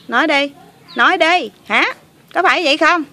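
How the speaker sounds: accusing demand. Pretend. Girl accusing her helpers of taking money, angry demand. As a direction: press hard for an answer.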